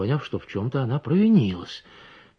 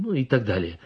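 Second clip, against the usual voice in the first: plain reading voice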